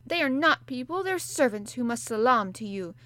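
put-on enraged child yelling; raging. A narrator's reading. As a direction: lash out in humiliation. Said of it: "did not even try to control her rage".

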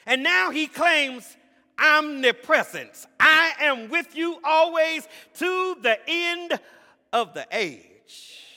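loud, rising sermon cadence; preaching at full force. Acted. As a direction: build the sermon to a roaring peak.

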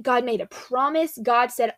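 ordinary talk, nothing marked

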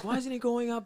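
high mock Cockney shout; imitating angry East London fans; East London accent. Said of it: "that thick East, East London accent".

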